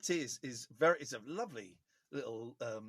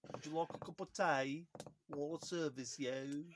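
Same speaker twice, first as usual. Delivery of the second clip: put-on drawn-out Brummie accent; 4 dB quieter, slower than usual. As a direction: put on Brummie, dragging out the ending. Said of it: "Have you got a Brummie?".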